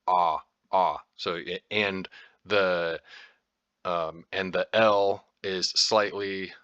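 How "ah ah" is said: "ah, ah" open like the a in father. Shown as a demonstration. Explaining the letter A, demoing the sound. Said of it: "pronounced like the A in father".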